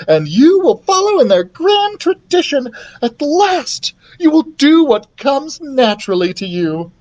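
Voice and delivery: high, grand proclamation; proud excited queen. Acted. Queen tells son of Charming tradition, proud.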